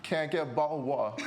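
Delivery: quick, playful British accent attempt; fast British impression on the edge of laughter. Shown as a demonstration. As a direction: rattle it off in a mock British accent. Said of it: "can you do some of the accents".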